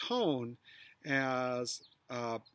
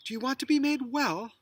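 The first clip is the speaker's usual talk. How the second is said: "be" raised high, falling finish; stress on be; 8 semitones higher, 3 dB louder, faster than usual. Shown as a demonstration. Teacher testing a falling take with stressed "be". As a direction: hit "be", then let the question fall. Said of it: "you could emphasize any one of those words".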